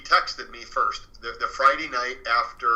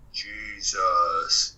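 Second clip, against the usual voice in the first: deep, slow guttural demon; creepy demonic voice; 6 semitones lower than usual. Retold or imitated. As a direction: draw it out low and creepy, like a demon. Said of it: "in this creepy, deep, guttural voice".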